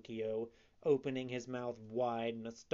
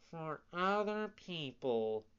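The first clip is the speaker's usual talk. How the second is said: echoing cat; 5 semitones higher than usual. Acted. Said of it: "echoed the cat".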